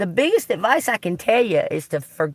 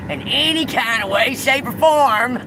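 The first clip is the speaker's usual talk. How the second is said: real irritation; 7 semitones higher than usual. Real, not acted. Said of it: "that irritates the fuck out of me".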